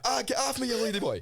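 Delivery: high, loud mock yelp; retold yelp. Telling his pal how he shrieked at the lady boy, high and loud. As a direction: push her off with a yelp. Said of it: "I went".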